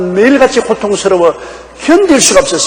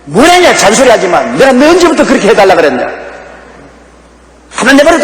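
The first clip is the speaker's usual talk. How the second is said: higher, louder mock shout; angry husband; 5 semitones higher, 4 dB louder, faster than usual. Husband rebuffing his kind wife, mock angry shout. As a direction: bark him off like a cornered husband.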